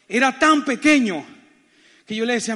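ordinary delivery

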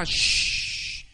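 mother's sharp shushing sound; imitated hushing shh. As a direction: shush a noisy child. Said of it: "aquí no se grita".

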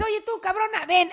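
high-pitched sweet call to the dog. Retold or imitated. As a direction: coax the dog over in a high voice. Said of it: "le dices".

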